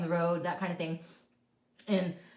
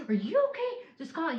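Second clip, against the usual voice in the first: worried husband; 8 semitones higher than usual. Retold or imitated. Husband checks on her.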